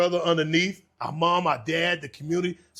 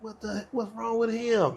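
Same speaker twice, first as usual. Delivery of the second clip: slow, puzzled "what's wrong with him"; a baffled wife staring at a crying guest; slowed, mildly bewildered wife; wife's bewildered question; 6 semitones higher than usual. Retold or imitated. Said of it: "my wife looking at him like".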